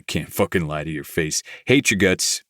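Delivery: plain reading voice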